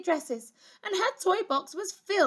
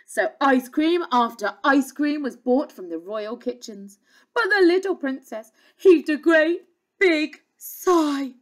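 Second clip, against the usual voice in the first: ordinary delivery, nothing marked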